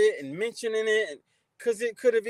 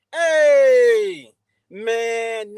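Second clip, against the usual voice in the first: big sudden shouted hey; loud hey; 11 dB louder than usual. Real, not acted. Greeting a nine-month member, a loud sudden hey. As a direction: burst out a greeting.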